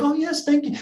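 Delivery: a grateful German-sounding jogger, voiced high; German-ish accent. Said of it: "who had the accent".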